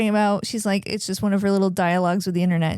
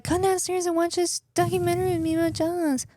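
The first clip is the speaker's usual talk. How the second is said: higher, soft mock invitation; a lightly put-on higher voice, coaxing; imitating Josh; 10 semitones higher, 3 dB quieter than usual. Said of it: "Josh was like".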